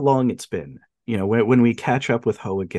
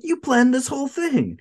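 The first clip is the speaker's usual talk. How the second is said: high-pitched outraged disbelief, imitated; mock accusation; 12 semitones higher than usual. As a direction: voice someone catching out a schemer. Voices Ho accusing Wang.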